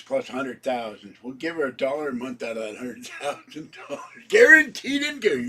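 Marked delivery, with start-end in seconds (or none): 4.3-5.5 laughing through the words; breaking up with laughter. Real, not acted. Laughs at own joke.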